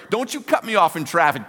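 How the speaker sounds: loud, high, fired-up warning; mock challenge. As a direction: take on a driver like a pumped-up fighter. Fired-up fan challenging a driver.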